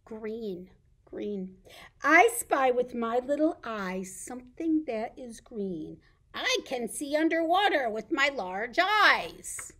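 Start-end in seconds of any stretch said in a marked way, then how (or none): 2.0-9.7 loud, swooping read-aloud; reading to children. Pretend. Reading a green riddle to toddlers, lively read-aloud. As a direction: play up each clue for little listeners.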